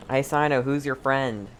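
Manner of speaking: ordinary delivery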